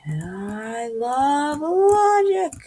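high, slow dopey drawl; mock them as dim-witted, stretching it out. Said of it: "They're like".

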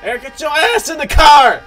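loud, high yell to get in; shouted order. Real, not acted. Player calls Eric into the car, shouting an order. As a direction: holler urgently at a straggler.

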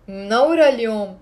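usual speaking voice

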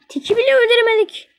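high, loud exasperated outburst; couldn't even kill Tick; frustrated cry. Real, not acted. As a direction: wail at the failure. Failed kill, upset.

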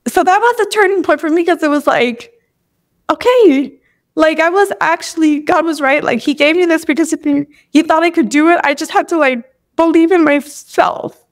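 choked up for real; tearful. Real, not acted. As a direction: speak through rising tears, pitch lifted. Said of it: "I don't know why I'm crying".

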